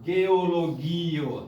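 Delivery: "geologio" drawn out slowly, stressed on GI; penultimate stress. Shown as a demonstration. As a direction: lean on the gi of "geologio". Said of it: "sempre a tônica tá lá no".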